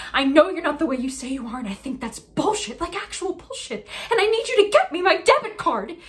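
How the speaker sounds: rising from firm to a full shout; building angry rant. Acted. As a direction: escalate until you're yelling.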